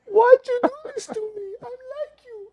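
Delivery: very high, whimpering mock-cry; hysterical woman impression. Man imitating the woman who thinks he drugged her, high tearful wail. Voices the panicking woman accusing him.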